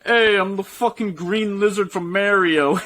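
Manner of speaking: high, drawn-out mock Italian accent; hammed-up Italian accent, slow and high. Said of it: "it's just, like, this Italian voice".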